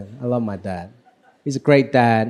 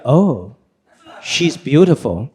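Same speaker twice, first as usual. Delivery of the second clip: lower, slow dad impression; lightly voiced old Chinese dad; 6 semitones lower, much slower than usual. Imitating his dad praising a woman, low and slow.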